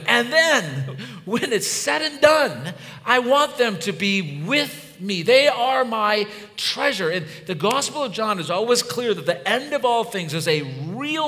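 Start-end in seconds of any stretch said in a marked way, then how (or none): 0.1-9.1 loud, high pulpit voice; impassioned preaching. Real, not acted. Preaches at full force.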